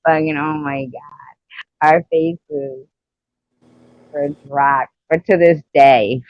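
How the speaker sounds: usual speaking voice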